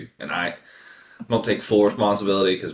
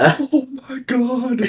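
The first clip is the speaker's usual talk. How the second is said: high mock relief; 4 dB louder, much slower than usual. Wife's relief quoted.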